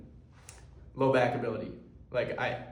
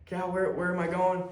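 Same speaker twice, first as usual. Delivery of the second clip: higher-pitched retold panic; scared; 6 semitones higher than usual. Sister calls to sibling as she loses sight, scared. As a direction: imitate someone lost and frightened. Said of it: "I've never seen her this scared".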